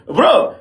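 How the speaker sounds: loud, high laugh of disbelief into "bro"; re-enacted stunned cackle; shocked laugh. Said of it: "I'm like".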